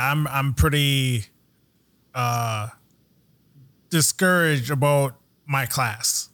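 low, halting, mildly dejected delivery; bummed retelling. Retold or imitated. Host retelling a driver's letdown about his class, bummed. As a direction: imitate a discouraged driver, slow and low. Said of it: "he was, he was bummed".

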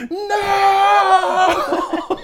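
very high, loud drawn-out wail; hammed-up screaming child refusal; imitated tantrum shout. Father mimicking child hearing the timer, screamed wail.

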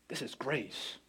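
almost breathing "This is grace" in wonder; quiet, reverent realization; hushed awe. Retold or imitated. Wife realizes grace, awed.

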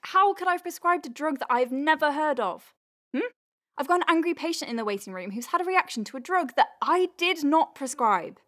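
exasperated rant about a changed prescription; frustrated. Acted. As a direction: vent the frustration at the colleague.

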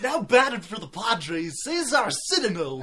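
loud, high announcer mangling the name; Harry Carey impression. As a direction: botch the name like an old announcer. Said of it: "he'd always get their last name wrong".

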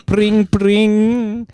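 high, ringing "pring, pring"; imitated shop bell. Opening a tea shop scene, high mimicked bell. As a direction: mimic a doorbell with your voice.

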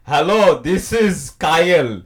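put-on loud, slow Indian-accented phone greeting; drawn-out mock Indian caller; Indian-accented scam caller. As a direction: mimic a call-centre scammer's Indian accent. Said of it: "the guy called me".

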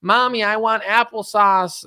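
mock toddler pleading for a snack; little kid voice. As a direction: beg like a small kid at the table. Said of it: "It's like a kid being like".

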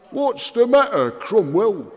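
put-on high, loud drunken taunt; drunk hiccuping. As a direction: slur it like a drunk. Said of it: "hiccuped the drunk".

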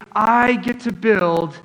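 slow, savoring each word with a smile; a worker proudly marveling at his calling; happy and awed. Retold or imitated. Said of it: "he's smiling, he's happy, he goes".

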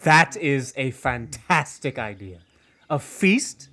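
ordinary talk, nothing marked

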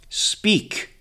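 high, breathy command of great power; commanding elder. A narrator's reading. Grandfather commanding the accuser to speak, powerful. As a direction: order it with ancient authority. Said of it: "The grandfather's voice has the power".

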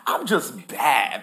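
mock someone in love with themselves; lightly voiced show-off; self-impressed. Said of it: "they just said".

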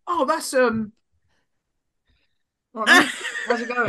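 stall, then cover with hearty cheer; bluffing greeting. Retold or imitated. Greeting someone whose name is forgotten, bluffing.

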